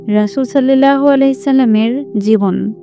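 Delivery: ordinary delivery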